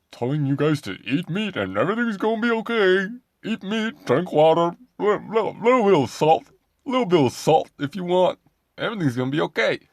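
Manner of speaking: loud, high-pitched mock accent; hammed-up accent impression, high and loud; Sean Baker. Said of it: "not a great Sean Baker accent".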